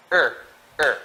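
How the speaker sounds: er said short and firm as "ihr"; correct prefix. Shown as a demonstration. Teacher models the right prefix, short separate er as "ihr". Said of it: "should be".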